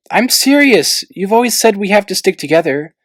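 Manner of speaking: insist, earnest; earnest insistence. A narrator's reading.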